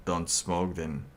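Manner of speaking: ordinary talk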